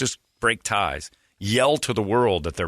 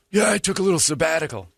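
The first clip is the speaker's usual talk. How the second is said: higher, put-on self-excusing voice; Jeremy; 7 semitones higher than usual. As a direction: mimic a colleague airily explaining himself. Mocking Jeremy's reply.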